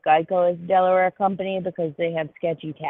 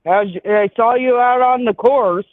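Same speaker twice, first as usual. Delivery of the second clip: a hint of a hearty old boys' club greeting, slightly louder; glad-handing old business guy; 2 semitones higher, 4 dB louder than usual. Imitating red-faced old insurance guys, a lightly voiced hearty greeting. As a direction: lightly play a back-slapping golf buddy.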